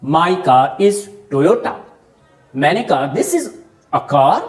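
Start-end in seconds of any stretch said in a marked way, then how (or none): 0.1-1.7 slow example. Shown as a demonstration. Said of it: "उसे कहोगे positive adjective".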